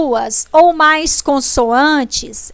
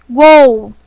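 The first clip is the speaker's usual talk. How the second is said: "gol" said louder, leaning on the O; stressed syllable shown; 7 dB louder than usual. Shown as a demonstration. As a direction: punch the O of "gol" to show it is the vowel. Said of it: "Quem eu falei com mais força". ("gol" em voz alta, com força no O)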